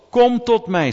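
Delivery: loud, high call. Acted. Preacher voicing Jesus calling all to him, loud call. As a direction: call it out across the hall.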